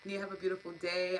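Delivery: usual speaking voice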